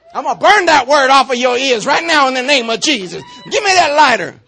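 loud, high, laughing preacher's shout. Acted. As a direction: call it out big with a laugh. Mock burning a word off ears.

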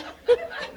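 short squeal of laughter, very high. Real, not acted. Enjoying the driver story with the audience, high laugh.

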